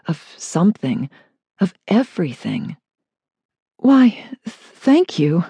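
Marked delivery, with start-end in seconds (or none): 3.8-5.4 flustered, tripping on the first word; stammering. Acted. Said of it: "she stammered".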